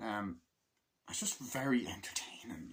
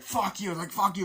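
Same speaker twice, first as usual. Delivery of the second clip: high, louder mock yell; mock angry shout; 10 semitones higher, 6 dB louder than usual. Soldiers arguing in the film, mock angry yelling. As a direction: bark it back at him.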